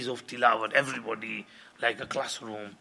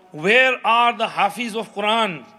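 calling out; 8 semitones higher, 10 dB louder than usual. Retold or imitated. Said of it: "someone called out".

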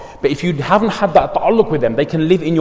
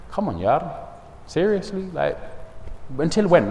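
put-on quiet, gentle appeal to an old student; soft pleading; 8 dB quieter than usual. Gently confronting former student.

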